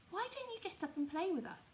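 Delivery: high, bouncy rabbit voice, teasing; high playful wild rabbit; furry rabbit voice. Acted. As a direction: coax him to come play, voiced high. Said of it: "one of them asked".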